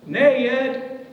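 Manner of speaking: "Ned!" called high and loud, stretched to two syllables like "Ne-ed!"; wife calling "Ned!" from the kitchen, high, loud, two-syllable. Retold or imitated. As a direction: yell "Ned!" across the house in two syllables. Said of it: "for Deborah, it's two syllables".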